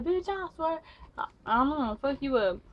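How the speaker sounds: high mock threatening snap; hammed-up tough girl swearing revenge; angry threat. Girl threatening Demonte, high mock angry voice.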